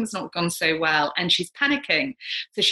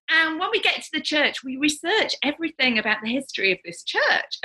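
normal talking voice

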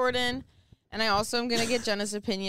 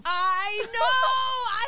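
loud, high burst of agreement; excited shout; 11 semitones higher, 5 dB louder than usual. Real, not acted. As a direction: yell it out in delight.

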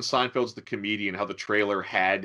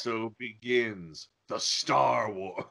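slow dramatic movie trailer delivery; portentous voiceover, slowed way down; dramatic trailer line; 4 dB quieter, much slower than usual. Retold or imitated. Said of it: "in a very dramatic, misplaced way".

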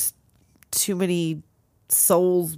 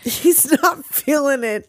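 higher, amused echo through laughter; laughing; 4 semitones higher than usual. Real, not acted. Co-host echoing the silly paraphrase, laughing. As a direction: repeat it while cracking up.

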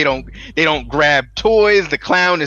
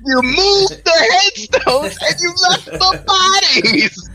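higher, slow, hammed-up rage; mock furious repeat; 12 semitones higher, slower than usual. Husband repeating it at his boss, slow mock rage. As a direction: lean on each word like a shaking fury. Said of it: "And then he repeats it again".

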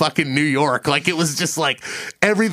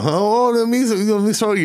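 mock Italian accent, lightly higher; imitating an Italian waiter's accent; 5 semitones higher than usual. Retelling the Italian waiter, in a light mock Italian accent. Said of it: "he's got the accent and everything".

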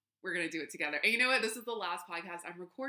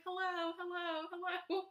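high, mock echoing hellos bouncing back; echo imitation; 9 semitones higher than usual. Podcaster mimics her voice bouncing around the hotel room, mock echo. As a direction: imitate your voice echoing off canyon walls. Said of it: "Like I'm in the Grand Canyon".

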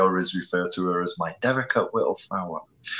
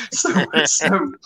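ordinary delivery

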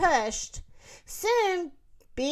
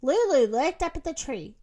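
ordinary delivery

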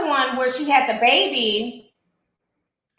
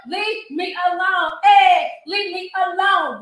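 drawn-out, high mocking leave me alone; mock sing-song; 4 semitones higher, slower than usual. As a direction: stretch it out like a taunting chant. Mocking the clip, sing-song repeat.

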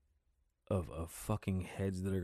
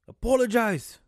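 loud, high mock yell of "Apologize"; hammed-up shout demanding an apology; imitated yell; 11 semitones higher, 9 dB louder than usual. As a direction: bark the order like an angry driver. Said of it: "Yelling at him".

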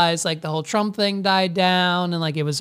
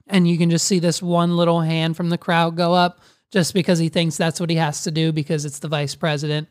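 ordinary talk, nothing marked

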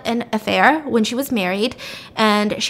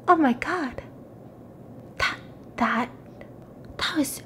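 stunned realization; 3 semitones higher, 8 dB quieter than usual. Retold or imitated. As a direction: falter as the realization dawns. Acted dawning realization.

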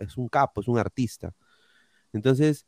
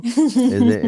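mock Bolivian accent; 11 semitones higher, 7 dB louder than usual. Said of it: "acento boliviano como el de Evo Morales".

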